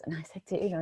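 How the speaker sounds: normal talking voice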